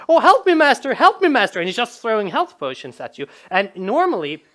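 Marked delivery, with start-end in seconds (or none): 0.1-1.6 high, loud mock panic; rabbit pleading for help. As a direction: cry for rescue as a hapless sidekick. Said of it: "and said".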